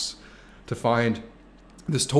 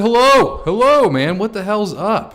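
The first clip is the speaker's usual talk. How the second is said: much higher and louder hyped hello; excited greeting; 10 semitones higher, 10 dB louder, slower than usual. Acted. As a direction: greet the chat like an old friend, loud. Reading viewer's greeting.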